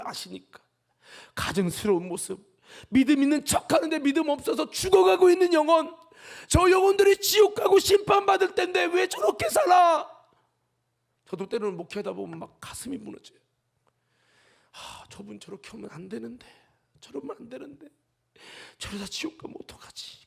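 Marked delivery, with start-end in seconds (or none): 2.9-10.1 shouted high, loud sermon climax; fiery preaching. Acted. Preaching at full force. 14.7-20.3 soft, much quieter, half-whispered worry; worried murmur. Retold or imitated. Pastor voicing his anxious thoughts.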